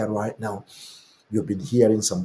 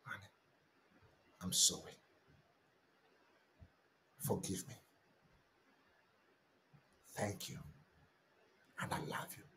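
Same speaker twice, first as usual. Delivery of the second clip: much softer, near-whispered confession; hushed prayer; 2 semitones lower, 19 dB quieter, faster than usual. Shown as a demonstration. Pastor repeats the confession in a hushed, whispered prayer. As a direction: breathe it like a secret prayer. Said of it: "confession dropped to a whisper".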